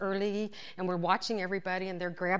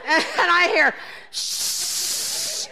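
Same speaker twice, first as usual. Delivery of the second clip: laughing; 10 semitones higher, 8 dB louder, much slower than usual. Real, not acted. Laughing, then shush.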